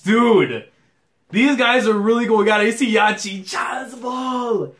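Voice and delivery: high-pitched hype, rising to a shout; excited commentary. Real, not acted. Reacting to players going at it, excited play-by-play. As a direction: get carried away by the rally.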